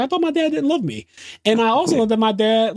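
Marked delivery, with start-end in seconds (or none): 0.0-1.1 high, pleading whine; raised childlike voice, much higher; imitating Zeke. Podcaster voicing Zeke's hurt realization, high and pleading.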